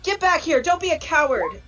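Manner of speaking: high, loud in-character yell; taunting shout. Acted. Player's character mocking a fleeing harpy, shouted taunt. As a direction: shout it after a fleeing foe. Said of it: "I will shout, shout back".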